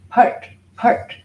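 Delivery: "part" as a short burst ending in a curled-back t; retroflex pronunciation. Shown as a demonstration. Teacher showing the retroflexive sound, "part" cut short. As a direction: curl the tongue back to stop "part". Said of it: "It's a retroflexive teeth".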